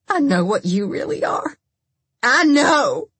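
accusation building to a high, loud yell; furious hatred. A narrator's reading. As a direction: spit hatred, then shout it. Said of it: "with a pure and furious hatred".

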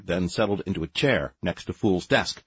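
ordinary delivery

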